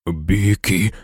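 low, mild groan; moaned. A narrator's reading. Hagrid fearing for his beast Beaky, moaning. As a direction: let the name out as a low moan. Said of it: "простогнав Гегрід".

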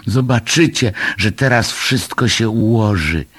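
a touch of high-pitched optimism; cheerful exclamation. A narrator's reading. Moomin reassuring everyone as sun returns, mildly excited exclamation. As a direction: lift the voice, mildly jubilant. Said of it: "wykrzyknął Muminek".

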